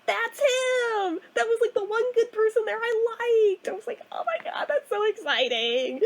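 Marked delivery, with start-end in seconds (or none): 0.0-3.7 imitating a thrilled sister, high-pitched; giddy and excited. Sister hearing about her old coworker, giddy and excited. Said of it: "she was so giddy and excited". 4.1-6.0 re-enacted squealing delight, slowing on "exciting"; thrilled. Replying to giddy sister, thrilled.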